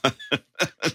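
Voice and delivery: short high laugh; high burst of laughter. Real, not acted. Laughs at own money story.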